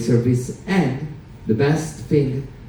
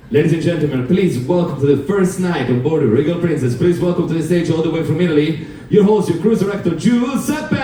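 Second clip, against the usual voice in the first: rising build to the name; stage announcer; 5 semitones higher than usual. Acted. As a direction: announce the host with a flourish. Introduces himself like an announcer.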